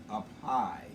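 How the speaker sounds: ordinary delivery, nothing marked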